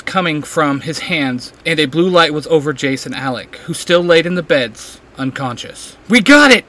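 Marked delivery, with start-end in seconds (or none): 6.1-6.6 loud, high triumphant shout; high, loud cry of victory; excited shout. A narrator's reading. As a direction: shout the news as she runs in. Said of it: "Clary shouts".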